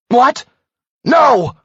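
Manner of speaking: loud high shocked shout. Acted. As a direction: refuse the news at full voice. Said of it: "Nick shouted".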